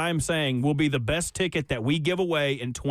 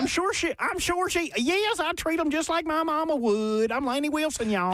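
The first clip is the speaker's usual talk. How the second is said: high, hammed-up Southern twang; pitched-up country twang, playing her; 10 semitones higher than usual. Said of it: "you with that accent making fun of her accent".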